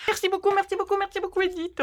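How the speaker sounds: high, giddy rush of thanks; overjoyed, high-pitched repeated thanks; silly little gushing voice. Real, not acted. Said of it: "je recommence avec ma petite voix débile".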